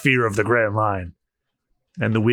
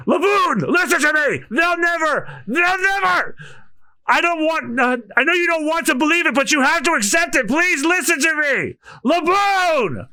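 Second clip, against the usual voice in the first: high pleading yell to the whale. Acted. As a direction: beg the whale to listen. Old man yelling at whale.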